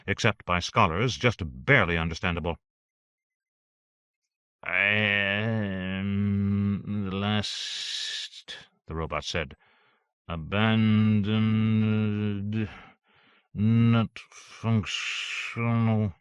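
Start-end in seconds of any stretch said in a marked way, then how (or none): 4.6-8.6 very slow, croaking machine voice; harsh robot croak. Acted. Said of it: "a harsh croak". 10.3-16.1 very slow, croaking machine voice; harsh robot croak. Acted. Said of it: "a harsh croak".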